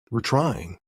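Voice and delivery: usual speaking voice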